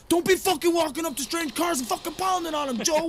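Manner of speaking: high, fast mock threat; imitating gangbangers' warning. Gangbangers warning Joe off, imitated high and fast. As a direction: ham up the thug warning.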